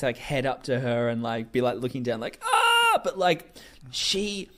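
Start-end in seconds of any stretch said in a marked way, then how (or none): 2.4-3.0 short, high, loud "ah"; imitated high-pitched wail; melodramatic mock cry. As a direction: yelp it, hammed-up. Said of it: "the most melodramatic pose".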